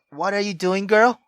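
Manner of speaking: normal talking voice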